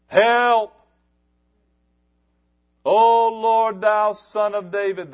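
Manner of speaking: crying out high and loud for help; desperate loud cry for mercy. Acted. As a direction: shout to the Lord as he passes. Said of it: "they said it in a loud voice".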